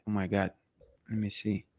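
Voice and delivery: ordinary delivery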